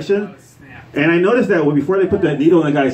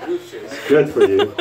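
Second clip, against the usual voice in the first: mock cheery; slower than usual. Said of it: "I'm like".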